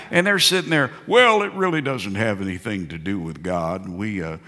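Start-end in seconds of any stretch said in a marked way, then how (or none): 1.1-4.4 lower, softer smug expert voice; mock TV pundit. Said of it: "You see 'em on T V".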